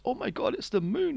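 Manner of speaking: high, awed mock voice; mocking Mewtwo. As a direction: gasp in mock wonder. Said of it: "Mewtwo goes".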